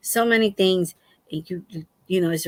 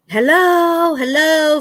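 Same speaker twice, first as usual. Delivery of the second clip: calling out; 6 semitones higher, 4 dB louder, much slower than usual. Retold or imitated. Calling into possibly burgled house.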